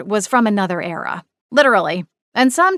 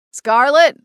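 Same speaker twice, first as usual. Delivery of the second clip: louder call across the house; 6 semitones higher, 6 dB louder than usual. A narrator's reading. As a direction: call her name from the hall. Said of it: "Lucas called".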